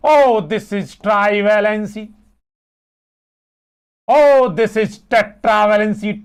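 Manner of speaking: slow, drawn-out "Oh" before each term; mock naming announcement. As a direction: stretch it out like a grand naming. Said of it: "इसको कहने लगे". (नामकरण का नाटकीय, खिंचा हुआ अंदाज़)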